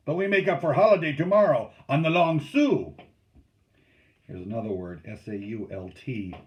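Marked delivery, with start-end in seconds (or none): 0.1-3.0 loud, high-pitched hearty call; steersman calling out. A narrator's reading. Steersman promises the crew a hard tomorrow, calling out. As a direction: project it to the whole canoe. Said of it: "the steersman said".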